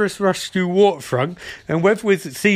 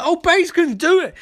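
excited; 10 semitones higher than usual. Retold or imitated. Retold excited cheer.

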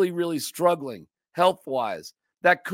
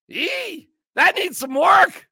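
high, loud sneering "Ee" at an ugly customer; mock snooty clerk; 10 semitones higher, 4 dB louder, slower than usual. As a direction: squeal it out as if judging a face. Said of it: "person and goes".

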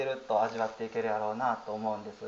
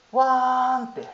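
imitated wail, higher and louder; toddler bursting into tears; 10 semitones higher, 6 dB louder, slower than usual. Daughter bursting into tears in father's arms, mock wail. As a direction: mimic a child starting to cry. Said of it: "泣き出したんですね".

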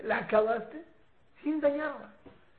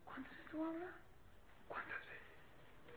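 very soft scolding, fading to a whisper; hushed telling-off, nearly whispered; lowered voice; 6 semitones higher, 22 dB quieter than usual. Shown as a demonstration. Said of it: "Bajando la voz".

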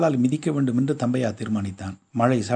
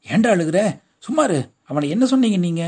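alarmed mother; 6 semitones higher, 5 dB louder than usual. A narrator's reading. Mother rushing in, boy crying.

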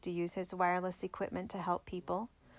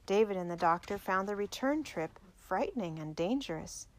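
usual speaking voice

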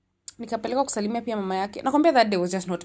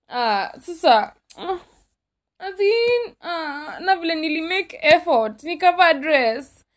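mock tearful whine; 7 semitones higher, 4 dB louder than usual. Complaining about the dress, tearful.

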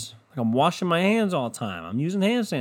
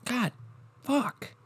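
ordinary delivery

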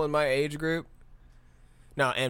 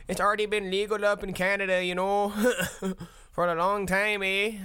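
higher, fast, hint of a Canadian accent ending on eh; a light joking Canadian accent; mock Canadian; 6 semitones higher than usual. Teasing about legal weed up in Canada, a light mock Canadian accent.

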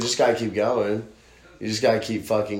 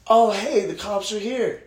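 high-pitched announcement to the operator; raised, high voice starting loud; high, eager mock report; acted calling out; 12 semitones higher, 4 dB louder, slower than usual. Retold call to the operator.

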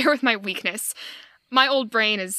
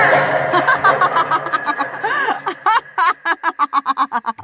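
triumphant laugh; 8 semitones higher, 7 dB louder than usual. Acted. Gloating over the hunt.

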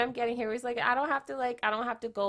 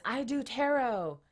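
slow, higher sign-holder announcing; mock protest sign; 4 semitones higher, 4 dB louder, slower than usual. Holding a sign outside people's houses, slow mock slogan. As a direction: read the sign out like a picket slogan. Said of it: "with a sign that says".

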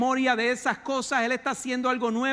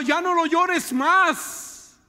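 God's voice; 7 semitones higher, 9 dB louder, much slower than usual. Acted. Preacher voicing God to Samuel.

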